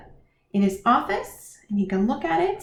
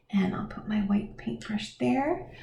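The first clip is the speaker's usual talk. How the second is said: whisper; 8 dB quieter than usual. Real, not acted. Quiet aside while painting.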